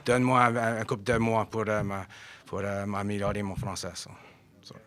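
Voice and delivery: Acadian French, spoken a little haltingly; Acadian accent. Real, not acted. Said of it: "je parle acadien".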